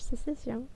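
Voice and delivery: "sécession" said the French way, falling; French "sécession" with a nasal "-on" ending; French pronunciation. Shown as a demonstration. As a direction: say "sécession" as "say-seh-syon". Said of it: "say it in French".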